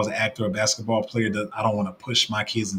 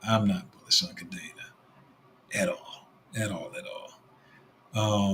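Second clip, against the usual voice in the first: softer and softer until barely voiced; fading away; 6 dB quieter than usual. Real, not acted. Streamer dismissing Kadena to chat, fading away. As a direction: let the at all trail off to nothing.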